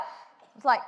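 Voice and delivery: ordinary delivery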